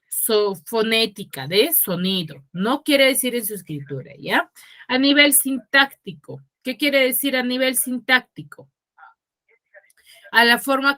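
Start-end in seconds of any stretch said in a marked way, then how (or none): none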